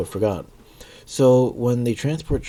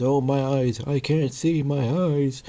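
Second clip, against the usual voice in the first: high, drawn-out mock wail; Wesker freaking out; 6 semitones higher, slower than usual. Vlogger imitates Wesker blinded on waking, slow high wailing. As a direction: wail it in hammed-up panic. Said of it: "he's going crazy. He can't see anything".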